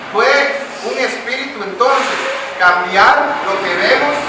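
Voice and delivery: loud, rising preacher's appeal; preaching at full force to the brothers; fervent preaching. Acted. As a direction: press the question on the congregation.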